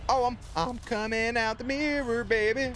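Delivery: freaked-out shriek. Real, not acted. Streamer panicking at the ghost from the mirror, high shrieks. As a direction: squeal as the ghost crawls out.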